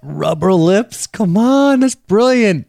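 high, loud, thrilled squeal; delighted shriek. Real, not acted. Delighted reaction to listener joke.

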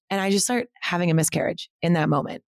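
usual speaking voice

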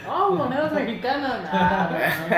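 put-on high, loud gringo-accent Spanish; American accent Spanish. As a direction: marvel like an American tourist. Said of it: "con acento de gringo".

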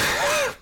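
a burst of high laughter. Real, not acted. Cohost laughing at a roast line. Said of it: "Funny one".

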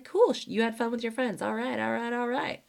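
mock laid-back cool parent; cool guy. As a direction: act totally unbothered. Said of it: "totally went the cool guy route".

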